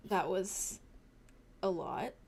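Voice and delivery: quiet. Acted. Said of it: "he just kinda goes".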